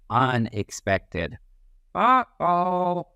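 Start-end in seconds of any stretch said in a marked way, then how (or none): 1.9-3.1 mock uh-oh. Shown as a demonstration. Warning of unexpected behavior.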